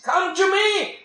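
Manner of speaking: high ringing divine call; fervent call. Acted. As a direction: cry out the invitation. God's call voiced in sermon.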